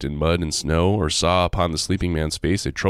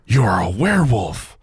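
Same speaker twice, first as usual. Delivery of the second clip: horrified gasp; 4 semitones higher, 4 dB louder than usual. Acted. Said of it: "The miller gasped".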